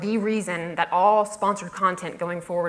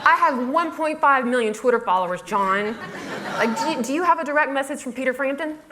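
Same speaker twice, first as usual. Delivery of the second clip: raised, challenging one-upmanship; mock indignant boasting; 5 semitones higher than usual. Blogger out-bragging her boss for laughs, mock indignant. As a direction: fire off rhetorical questions to outdo him.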